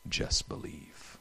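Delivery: slow, hushed whisper; whispered reassurance. Retold or imitated. Jesus to the grieving father, slow tender whisper. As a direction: breathe it like a gentle promise.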